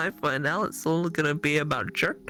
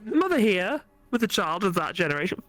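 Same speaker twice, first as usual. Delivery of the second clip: ordinary talk, nothing marked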